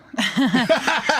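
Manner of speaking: bursts out laughing; a short real laugh of surprise. Real, not acted. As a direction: caught off guard, laughs. Guest amused by question.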